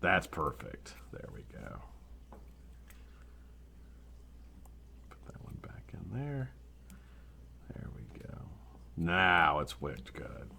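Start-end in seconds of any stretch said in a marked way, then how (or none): 1.1-10.5 whispered while working; quiet concentrated whisper; whisper to self. Real, not acted. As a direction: breathe the words as you fiddle. Rewicking quietly to himself.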